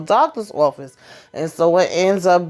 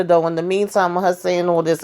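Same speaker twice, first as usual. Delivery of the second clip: ordinary talk, nothing marked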